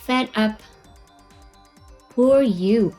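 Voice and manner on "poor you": normal talking voice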